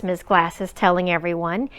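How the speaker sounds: plain reading voice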